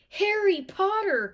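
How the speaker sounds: loud, high, slow awed chant; chorused. Acted. Twins chorus the famous name, slow loud awe. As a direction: say it together in awe. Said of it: "chorused the twins".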